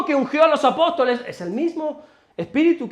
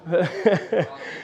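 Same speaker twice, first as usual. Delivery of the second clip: short real chuckle, slightly lower and softer; amused chuckle; 4 semitones lower, 4 dB quieter than usual. Real, not acted. Preacher chuckling at a teen who wants fame, soft chuckle. Preacher laughs at teen's dream.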